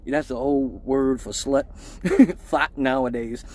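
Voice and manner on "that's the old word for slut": ordinary talk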